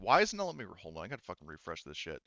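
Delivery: ordinary talk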